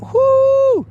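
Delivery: quick falsetto whoop; sudden high "whoo" cry. Real, not acted. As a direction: burst out with a yelp. Excited holler mid sentence.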